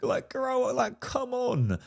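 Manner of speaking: high, drawn-out sassy mock; camp sassy voice. Coach mocking her test in a sassy high voice. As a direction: toss it off like a sassy diva, slow and high.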